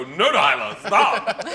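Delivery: loud, high mock protest; exasperated dad. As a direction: plead for it to stop, half laughing. Retold dad begging daughter to stop.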